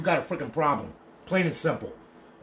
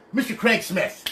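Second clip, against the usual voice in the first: usual speaking voice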